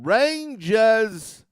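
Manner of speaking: slow, stretched New York "Rangers"; New York accent. Retold or imitated. Mocking rival fans in a New York accent. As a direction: drawl "Rangers" like a New Yorker. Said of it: "That's how they pronounce it".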